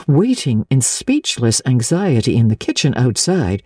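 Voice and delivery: plain reading voice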